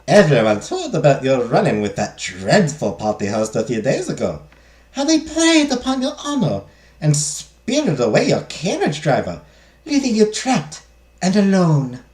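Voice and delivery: put-on dramatic maid in a French accent gone German; attempted French accent. Maid recounts madame's dreadful ordeal, attempted French accent. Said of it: "I'm trying for a French accent".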